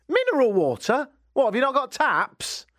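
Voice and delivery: high-pitched comic outrage; ranting disbelief, pitched well up; incredulous scorn. Acted. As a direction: question it as if utterly absurd. Scorn at shopper's forgotten water.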